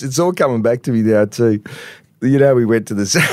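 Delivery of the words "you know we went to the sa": words collapsing into laughter; breaking up laughing mid-word; real laughing fit. Real, not acted. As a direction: start the sentence, then crack up helplessly. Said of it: "I'm sorry for laughing".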